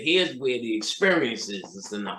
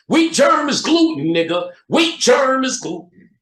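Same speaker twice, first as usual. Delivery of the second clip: shouting; 7 dB louder than usual. Acted. Ranting, shouted repeat.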